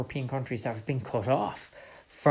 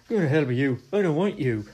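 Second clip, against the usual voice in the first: put-on louder, defiant teenage retort; bratty teen; 4 semitones higher, 6 dB louder than usual. Ireland as a bratty teen rebuffing Europe, louder defiant retort. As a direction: throw it back like a brash teenager. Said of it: "the brattiest teen you can imagine".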